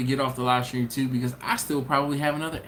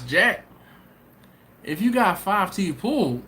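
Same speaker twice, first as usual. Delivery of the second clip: ordinary talk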